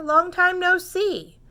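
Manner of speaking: loud, hearty hello; hare greeting. Acted. Hare greets Mouse, loud and cheerful. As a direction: call out to an old friend. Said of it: "says Hare".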